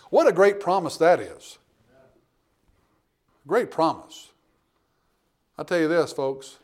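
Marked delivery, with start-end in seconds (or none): none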